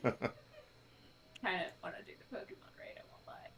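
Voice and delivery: breathy secret whisper, soft. Real, not acted. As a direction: confess it under your breath. Sheepish confession on stream.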